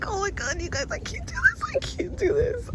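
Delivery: high-pitched near-crying panic; frightened whimpering I can't do this; panicking. Real, not acted. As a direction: voice cracking up high with real fear. Panicking alone on swamp trail.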